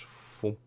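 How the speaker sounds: breathy soft puff of a sound; soft unvoiced foomp. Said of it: "it goes".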